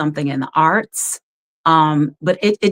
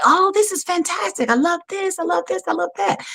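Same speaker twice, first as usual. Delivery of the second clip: much higher, gushing praise; gushing casting staff; 12 semitones higher than usual. Retold or imitated. Casting staff praise her homemade outfit, high and gushing. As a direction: gush over the outfit.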